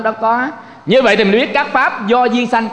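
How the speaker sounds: usual speaking voice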